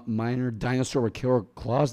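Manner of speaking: ordinary delivery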